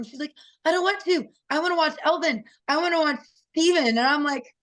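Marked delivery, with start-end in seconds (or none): 0.6-3.9 put-on higher, childlike pleading to stay; a young girl begging to keep watching the fights; daughter's pleading voice. Mom imitating daughter begging to stay, higher childlike plea.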